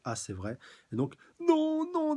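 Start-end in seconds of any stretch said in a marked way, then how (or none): 1.4-2.1 put-on high, loud, pleading little girl voice; a terrified child crying no. Terrified little girl begging the killer not to shoot, high and loud. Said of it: "déclare la petite".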